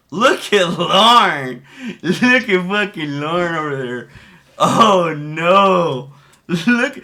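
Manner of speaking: drawn-out, loud gleeful call to look; excited shout. Real, not acted. Streamer hyping chat over a guy on screen, loud and gleeful. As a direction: hype up the screen moment.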